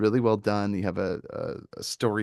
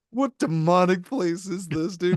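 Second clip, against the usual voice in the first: slow, high-pitched comic disbelief; mock incredulous; 8 semitones higher, 4 dB louder, slower than usual. Podcaster voicing a reaction to shrieking foxes, mock incredulous. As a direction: draw out the outrage as a baffled visitor.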